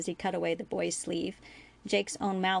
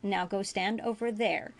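ordinary delivery, nothing marked

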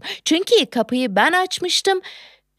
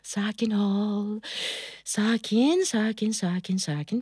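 soft, lowered voice, calming down; self-soothing; 4 semitones lower, 7 dB quieter, slower than usual. Acted. Woman telling herself to calm down, soothing. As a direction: talk yourself down softly. Calms herself after the outburst.

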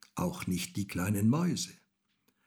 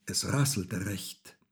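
plain reading voice

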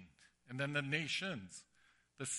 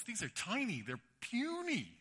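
mock disdain, stretching they're puny; scornful Andrew; 8 semitones higher than usual. Andrew dismissing the boy's small lunch, sneering.

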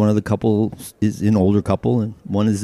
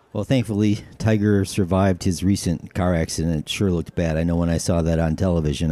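ordinary talk, nothing marked

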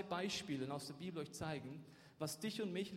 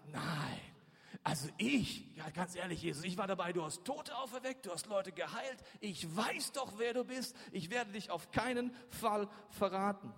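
earnest protesting, pitch lifting as it goes; mildly hammed-up vow of loyalty; Petrus; 3 semitones higher than usual. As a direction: insist you would never betray your friend. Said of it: "Da beteuerte Petrus".

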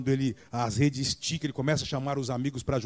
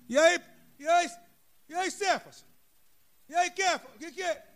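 mocking taunt. Others mocking Peter.

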